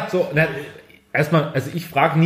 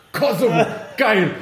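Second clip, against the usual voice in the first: excited; 6 semitones higher, slower than usual. Retold or imitated. Quoting own delight.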